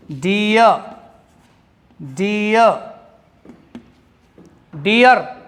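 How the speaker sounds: डियर said as "dee-uh", no rolled र at the end; teaching pronunciation. Shown as a demonstration. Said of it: "रे नहीं बोलेंगे इसको". (डियर को बिना र के "डीअ" बोलिए)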